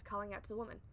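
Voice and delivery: ordinary delivery, nothing marked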